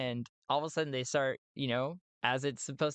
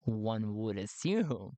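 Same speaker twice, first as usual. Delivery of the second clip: drawn-out, low "One would assume"; slow and low, weighing each word; slow, pointed repeat; 4 semitones lower, slower than usual. Real, not acted. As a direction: stretch the words out deliberately. Repeats it slowly for emphasis.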